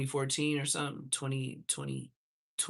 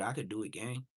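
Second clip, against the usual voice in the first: normal talking voice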